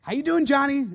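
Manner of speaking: bright mock hello to Johnny; youth pastor greeting. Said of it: "I hear this from thirteen-year-olds".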